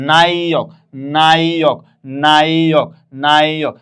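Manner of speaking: "নায়ক" loud, first syllable drawn out into "nai"; repeated falling "নায়ক", paused after each; pronunciation demo. Shown as a demonstration. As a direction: sound out "নায়ক" as a long nai, then ok. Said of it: "কিভাবে?".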